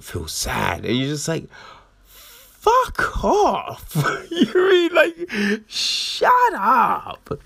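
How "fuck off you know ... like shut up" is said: much higher, squeaky outraged fuck off with a laugh; high-pitched mock outrage; exasperated squeal. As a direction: shriek it in comic disbelief. Exasperated reader reaction to character.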